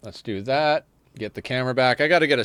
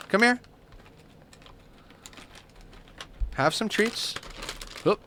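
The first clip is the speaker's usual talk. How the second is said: much higher, sing-song coax to the cat; high pet talk; 9 semitones higher, 4 dB quieter than usual. Acted. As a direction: call the kitty over sweetly. Coaxing cat with treats.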